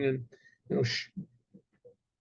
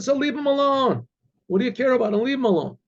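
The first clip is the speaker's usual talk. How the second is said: higher-pitched, exasperated telling-off; dismissive order; 7 semitones higher than usual. Retold or imitated. Old Cossack to the others, exasperated order. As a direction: wave them off with acted impatience.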